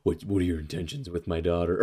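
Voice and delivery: low, quiet stern father; gruff overprotective dad. Retold or imitated. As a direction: grill the daughter's boyfriend, low and gruff. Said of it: "he's like".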